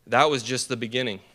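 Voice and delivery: ordinary delivery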